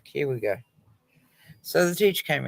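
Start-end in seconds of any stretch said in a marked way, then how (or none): 0.0-0.6 under-breath groan. Retold or imitated. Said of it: "And I'm like".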